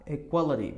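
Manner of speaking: EQUALITY said "equaleri"; right form with I. Shown as a demonstration. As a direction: sound the middle vowel of EQUALITY, tap the T. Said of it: "Não, tem o I". ("Equality" terminando em "eri")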